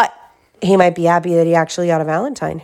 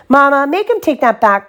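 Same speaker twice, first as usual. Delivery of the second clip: higher pleading cry to Mama; 8 semitones higher, 3 dB louder than usual. Acted. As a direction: appeal to Mama, aggrieved. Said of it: "cried Sister".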